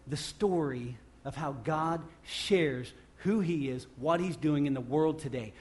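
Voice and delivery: ordinary delivery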